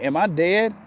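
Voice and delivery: half-shocked retold question; startled question. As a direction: blurt it out, cutting him off. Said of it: "I'm like".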